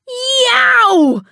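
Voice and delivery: a sudden high, loud yelp of pain; pained yell. Acted. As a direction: yelp as the hot water scalds. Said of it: "yelled Tom".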